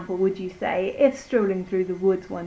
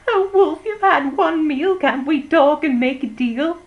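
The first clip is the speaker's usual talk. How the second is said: much higher, louder pleading squeal; squealing pig; 7 semitones higher, 9 dB louder than usual. Acted. The second pig begging the wolf for a deal, squealing high and loud. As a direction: beg the wolf like a squealing piglet. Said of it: "The little pig began to squeal and cried".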